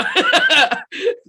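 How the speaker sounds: sudden high loud laugh; real laugh bursting out mid-story; burst of laughter. Real, not acted.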